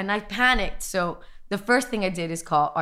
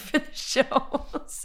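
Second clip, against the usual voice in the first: laughing; 6 semitones higher, much slower than usual. Real, not acted. Laughing at her own idea.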